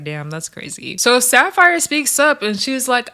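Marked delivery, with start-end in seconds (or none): 0.0-1.0 much softer, under her breath; muttered aside. Retold or imitated. Reacting to the bear spray news, a soft aside. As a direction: say it quietly to herself. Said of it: "I was like".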